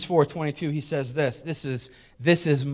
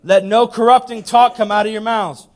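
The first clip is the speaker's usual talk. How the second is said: "Let no corrupting talk" higher and louder; emphatic repeat; 6 semitones higher, 11 dB louder than usual. Shown as a demonstration. Preacher rereading "Let no corrupting talk" to the church, emphatically. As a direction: proclaim "no corrupting talk" loudly, driving it home. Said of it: "Read that again".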